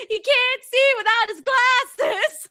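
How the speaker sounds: high, slow, half-laughing mock sob; mock crying girl. Streamer to chat, quoting My Girl in a laughing mock sob. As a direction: hams up the little girl's tearful line.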